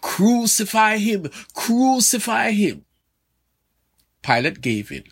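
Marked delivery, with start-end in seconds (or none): 0.0-2.8 slow, high, emphatic mob cry, each word pressed out; emphatic crowd shout. Retold or imitated. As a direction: drag out crucify like a demanding crowd. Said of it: "shouted emphatically".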